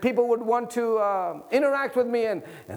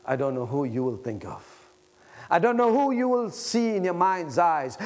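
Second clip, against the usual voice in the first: ordinary talk, nothing marked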